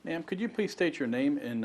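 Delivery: ordinary talk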